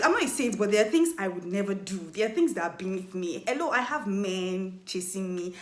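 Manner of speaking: ordinary delivery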